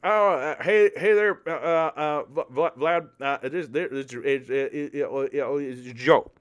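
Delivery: put-on high, halting old-man stammer; stammering Biden. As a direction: fumble through the call like a flustered old president. Biden on the phone.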